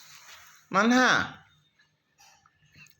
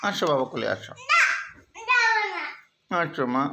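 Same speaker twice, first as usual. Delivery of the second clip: tender coaxing; 5 semitones higher than usual. Real, not acted. Father invites child onto lap.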